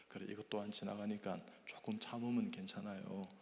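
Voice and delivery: gentle whisper. Retold or imitated. Professor comforting intern.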